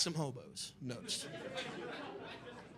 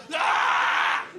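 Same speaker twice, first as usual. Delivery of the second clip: breathy shout; 5 dB louder than usual. Retold or imitated. Homeless man shouting into void.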